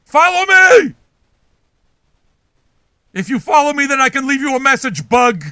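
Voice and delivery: shouting orders at a bug; yelling. Acted. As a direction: bellow at the bug to follow. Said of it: "You yell at them".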